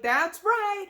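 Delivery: high, loud proud reply; SpongeBob. Retold or imitated. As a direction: answer up brightly in a cartoon voice. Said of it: "SpongeBob is just there ready to answer".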